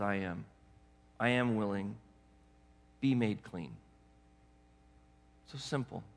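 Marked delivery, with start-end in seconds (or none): 0.0-3.7 gentle Jesus voice. Acted. Jesus answers the kneeling leper.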